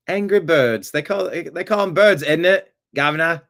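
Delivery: mock British accent drifting into Australian. Host mocking a UK college to chat, in a hammed-up British accent. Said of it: "Wait, that's Australian".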